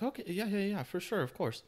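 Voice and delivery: high, bright-eyed eager yes; overeager agreement. Retold or imitated.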